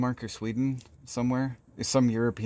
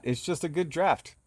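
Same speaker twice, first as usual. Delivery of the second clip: ordinary delivery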